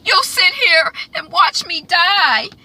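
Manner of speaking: hammed-up sobbing complaint; dying man crying out. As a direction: cry it out from the deathbed. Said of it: "Sir George, he's in the background".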